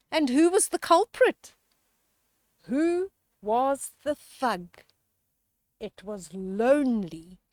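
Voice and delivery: lively rhyming storybook read-aloud; reading to children. A narrator's reading. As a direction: read the rhyme to the kids with relish. Rhyming picture book read aloud.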